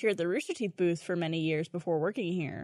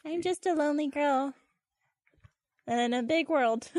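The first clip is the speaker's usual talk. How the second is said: put-on lightly higher, little-girl pout; lonely girl voice; 6 semitones higher than usual. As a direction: play the sad lonely girl. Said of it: "You'd be like".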